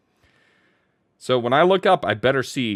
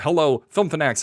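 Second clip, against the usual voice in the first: ordinary delivery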